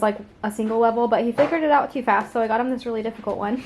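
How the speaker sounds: normal talking voice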